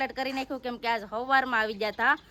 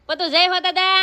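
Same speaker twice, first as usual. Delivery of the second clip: coaxing call; 7 semitones higher, 4 dB louder than usual. Real, not acted. Mother loudly prompts Patu to say the greeting.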